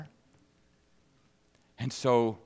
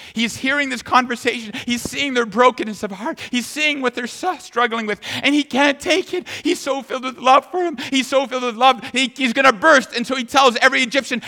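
impassioned preaching; 11 semitones higher, 8 dB louder than usual. Acted.

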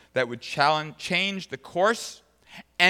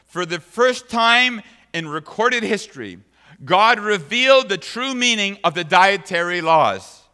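preaching at full force; 5 semitones higher, 8 dB louder than usual. Acted. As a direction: proclaim it as a great truth.

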